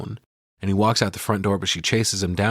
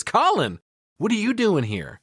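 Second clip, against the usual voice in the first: much higher, caught-off-guard question; imitating her shocked surprise; startled; 10 semitones higher than usual. Allie shocked to find her ex Colin there, voice jumping up.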